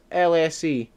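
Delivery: usual speaking voice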